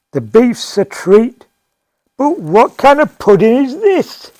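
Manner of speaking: loud, emphatic grumble to a waitress; complaining diner. Acted. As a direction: call the waitress over and build to a loud, high protest.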